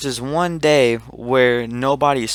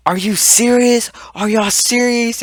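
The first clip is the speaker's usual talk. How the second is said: high-pitched, raised-voice mock indignation; incredulous scolding, pitched way up; imitated outraged teacher; 11 semitones higher, 3 dB louder than usual. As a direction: act out a teacher telling off the class. Teacher scolding laughing class.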